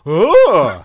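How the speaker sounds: high, loud exaggerated ooh; mock ooh. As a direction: gasp like an extra mugging for camera. Acting out commercial reaction faces.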